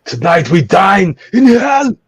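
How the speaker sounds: booming Austrian movie-star shout, slow; Arnold accent. Retold or imitated. Friend hamming Arnold for the group, loud slow Austrian accent. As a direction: bellow it like Arnold in an action film. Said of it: "I just threw in that Arnold accent".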